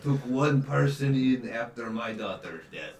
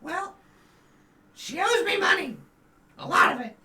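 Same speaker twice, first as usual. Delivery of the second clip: squeaky accusation; 7 dB louder than usual. Acted.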